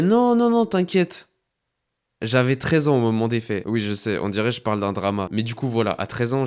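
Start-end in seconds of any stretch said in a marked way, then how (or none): 0.0-1.3 high, loud dismissive friend voice; mock reassurance. Friend reassuring him about the link, high mock reassurance. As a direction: brush off the worry in a high put-on voice.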